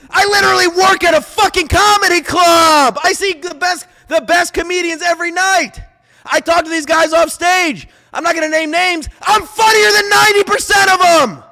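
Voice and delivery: high, loud boastful shouting; angry boasting. Real, not acted. Bragging to critics in a loud angry shout. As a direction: yell your brag at the doubters. Ranting about being funnier.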